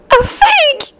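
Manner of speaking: very high tearful wail; sobbing cry. A narrator's reading. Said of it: "they heard Hermione sob".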